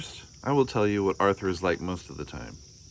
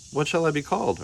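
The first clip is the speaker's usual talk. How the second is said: put-on child's voice; 9 semitones higher, faster than usual. Child Arthur voiced in story.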